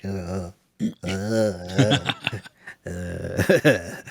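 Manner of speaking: low, soft groan of dismay; weary groan. Retold or imitated. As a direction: groan quietly as if the dialogue pains you. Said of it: "I was just like".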